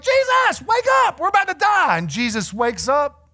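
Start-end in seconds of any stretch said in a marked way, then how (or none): 0.0-2.0 very high, frantic wake-up call; hammed-up desperate yelling to rouse a sleeper; mock panicked shout. Preacher voices disciples waking sleeping Jesus, mock panicked shout. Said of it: "And so they run over like".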